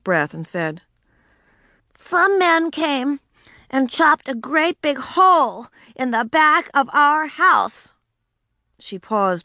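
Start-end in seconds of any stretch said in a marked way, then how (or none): 1.9-7.9 put-on high, eager little girl; a first grader with big news. Ramona's announcement.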